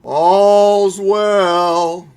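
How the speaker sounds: loud, ringing night-watch cry of all's well; hammed-up night watchman calling the hour; old-movie watchman call. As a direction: call it out like a town crier from an old film. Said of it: "seen those old movies?".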